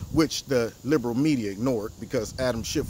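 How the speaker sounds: ordinary talk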